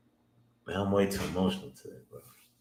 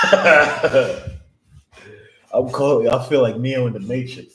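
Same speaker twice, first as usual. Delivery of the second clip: higher and louder, shouting with glee; hyped; 6 semitones higher, 10 dB louder than usual. Real, not acted. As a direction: yell it like you can't believe it. Hyped after calling the market.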